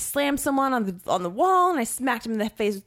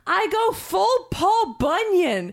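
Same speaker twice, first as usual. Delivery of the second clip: proud boast; 9 semitones higher, much slower than usual. Real, not acted. Repeats boast, higher.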